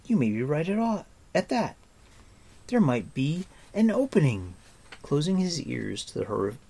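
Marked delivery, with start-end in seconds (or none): 0.0-4.5 put-on raised young hero voice, a bit quick; urgent youth, pitched up; Taryn's voice. Narrator voices Taryn's urgent call.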